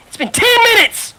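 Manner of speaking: loud, high, furious venting; heated rant. Real, not acted. Telling friends about the unfair write-up, loud angry rant. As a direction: build it into an outraged rant. Ranting about supervisor, rising.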